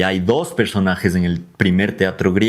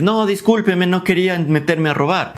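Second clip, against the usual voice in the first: pleading burglar, pitched up; high, acted apology from a robber; apologetic thief; 7 semitones higher than usual. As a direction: beg pardon as a caught thief. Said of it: "al ladrón decir".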